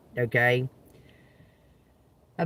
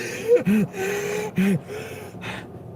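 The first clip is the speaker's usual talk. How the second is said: mock gasp. Acting out her sudden fit.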